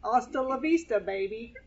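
lightly voiced action-star line; movie catchphrase. Pastor quoting a famous film line as a light impression. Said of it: "somebody else very famous that you have heard say that".

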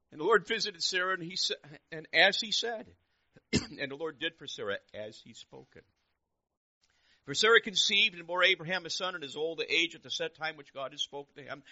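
high, very loud proclamation; forceful scripture reading. Real, not acted. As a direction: proclaim the verse to the whole room. Reads scripture at full force.

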